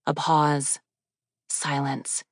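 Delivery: plain reading voice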